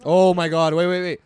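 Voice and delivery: loud, urgent interruption; loud exclamation. Real, not acted. Friend halting the group's antics, loud exclamation. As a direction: burst out loudly to stop everyone.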